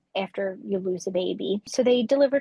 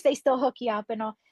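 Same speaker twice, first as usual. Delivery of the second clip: ordinary talk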